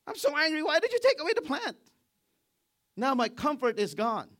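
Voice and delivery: very high, loud fast tantrum; a brat yelling at God. Acted. Jonah answers God angrily.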